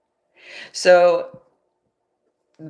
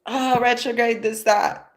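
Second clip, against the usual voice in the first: mocking everyone; 7 semitones higher than usual. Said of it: "Everybody's like".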